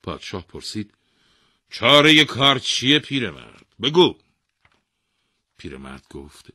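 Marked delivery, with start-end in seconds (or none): 1.7-4.2 loud, high royal command; demanding king. A narrator's reading. King questioning the old man, demanding. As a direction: demand the answer at full voice.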